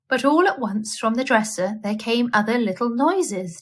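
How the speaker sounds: usual speaking voice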